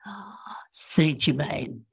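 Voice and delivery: soft, slow, whispery sigh of reverence; reverent sigh. Retold or imitated. Channelled master naming who is best, reverent sigh. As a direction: breathe it out softly like a sacred name. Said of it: "it was like almost singing".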